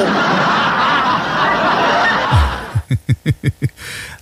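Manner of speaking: carcajada larga y aguda; burla a carcajadas. Real, not acted. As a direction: suelta una risa prolongada, alta, que se va apagando. Reacción burlona al mensaje leído. (risa aguda y larga)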